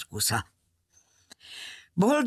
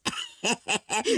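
short acted chuckle of an old seaman; sailor's laugh; 5 semitones higher than usual. A narrator's reading. Sailor is delighted by circus idea, acted laugh. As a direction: chuckle heartily in character as the sailor. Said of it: "A tengerész nagyot nevetett".

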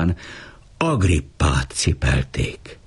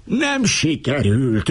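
much higher, slow, raving voice; 9 semitones higher, slower than usual. A narrator's reading. Alabarkosz raves at the failed plan, high and slow. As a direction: rage at the failure, drawn out. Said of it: "őrjöngött az Alabarkosz".

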